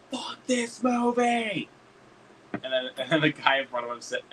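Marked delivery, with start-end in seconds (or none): 0.1-1.7 high, stretched chant-like heckle; mock yell. Two moviegoers heckling together after a countdown, mock yell. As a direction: draw it out high like a crowd heckler. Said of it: "me and him would say at the same time".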